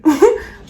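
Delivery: a short laugh shooting high and loud. Real, not acted. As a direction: let a sharp laugh burst out. Laughs at his line.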